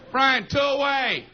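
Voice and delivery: high, drawn-out infielder's call; mock on-field shout, stretched out. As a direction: call slowly across a ball field. Infielder calling out to Brian.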